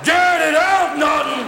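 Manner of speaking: hammed-up Cramden yelling; Ralph Cramden rant. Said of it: "transforming into Ralph fucking Cramden".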